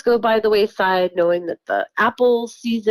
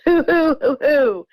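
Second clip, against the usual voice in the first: mock owl hoots; 5 semitones higher, 3 dB louder than usual. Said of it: "and it goes".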